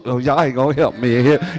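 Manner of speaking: ordinary delivery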